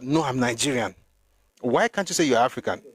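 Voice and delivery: ordinary delivery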